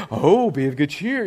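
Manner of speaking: put-on sweet change of tune; mock encouragement. Crowd suddenly all for the blind man, mock encouragement. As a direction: imitate the fickle crowd cheering him on. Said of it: "now they're all like".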